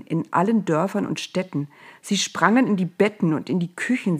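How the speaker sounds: usual speaking voice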